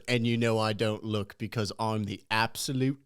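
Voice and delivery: mock British swagger; British accent impression. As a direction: imitate a British food vlogger's boast. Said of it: "It's this British guy".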